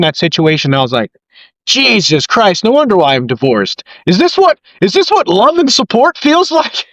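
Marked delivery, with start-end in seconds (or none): 1.7-6.9 mock incredulous, pitch climbing high; exasperated disbelief. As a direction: act out stunned disbelief at the kindness. Retold exasperated inner reaction.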